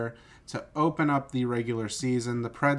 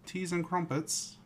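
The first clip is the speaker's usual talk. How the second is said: mock British accent; 5 semitones higher, 6 dB quieter than usual. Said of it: "British accent".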